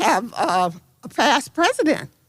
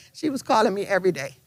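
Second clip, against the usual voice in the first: normal talking voice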